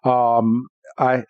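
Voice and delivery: ordinary delivery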